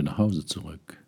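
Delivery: ordinary delivery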